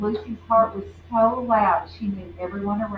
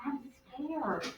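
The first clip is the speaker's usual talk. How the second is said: much softer, small "I'm scared."; timid whisper; 3 semitones higher, 11 dB quieter than usual. Acted. Ralph stuck and crying admits fear in a soft hush. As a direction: barely breathe it out.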